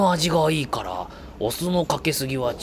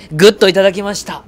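much louder and higher burst; excited exclaim; 8 semitones higher, 13 dB louder than usual. Acted. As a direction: psych yourself up to eat it. Loud fast exclaim.

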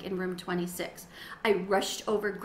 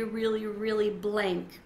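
ordinary delivery, nothing marked

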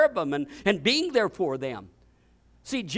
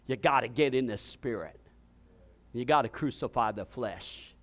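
normal talking voice

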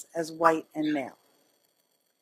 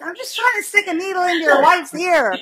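higher and louder, exasperated; 10 semitones higher, 8 dB louder than usual. Retold or imitated. Telling the audience what she thought about the husband, exasperated and louder. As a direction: exclaim it in disbelief. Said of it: "'cause I'm like".

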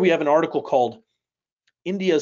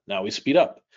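ordinary delivery

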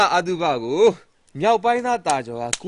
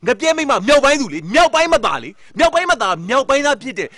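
higher, louder heated telling-off; angry scolding; 7 semitones higher, 6 dB louder than usual. Acted. Rich man scolds friend for asking another village, angry and loud. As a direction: tear into him for going to the rival.

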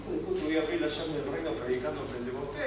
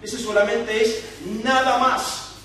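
louder, higher, punching each word; preaching climax; 7 semitones higher, 10 dB louder, slower than usual. Acted. Preacher urging Jairo to only believe, rising fervent preaching. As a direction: build up the fervor.

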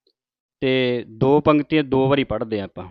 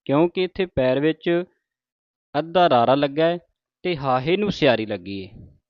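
ordinary talk, nothing marked

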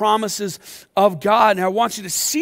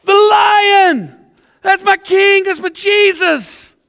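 much higher and louder cheering shout; jubilant shouting crowd; 10 semitones higher, 7 dB louder, much slower than usual. Acted. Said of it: "all God's people are like".

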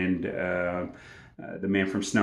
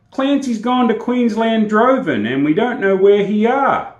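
lightly voiced rough bushman, higher; a hint of the unlettered shearing mate; shearing mate's note; 12 semitones higher, 8 dB louder than usual. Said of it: "verbatim I will quote it".

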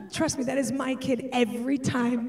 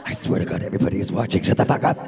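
hissed demonic whisper, far lower; satanic whisper; 5 dB louder, much faster than usual. Acted. Mom hisses a threat while everyone watches, demonic whisper. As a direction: threaten in a deep unvoiced hiss. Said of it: "lower satanic register of my voice".

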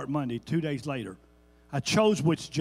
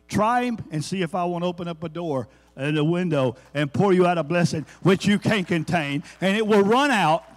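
building preacher shout; 3 semitones higher, 7 dB louder than usual. Real, not acted. Preacher to the church, swelling into a loud shout. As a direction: preach it up to a roaring peak.

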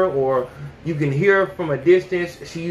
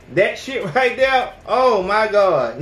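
ordinary delivery

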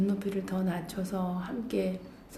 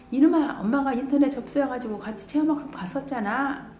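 mock scolding; 7 semitones higher, 6 dB louder than usual. Mom scolds child who forgot trip.

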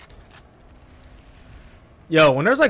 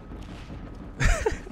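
breathy laugh; 9 dB quieter than usual. Real, not acted. Laughing at game.

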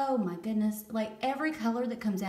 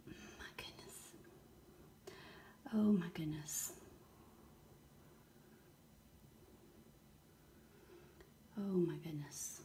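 quiet awe; 2 semitones lower, 11 dB quieter than usual. Real, not acted. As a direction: hush yourself and whisper in wonder. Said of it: "I'm just gonna be quiet now".

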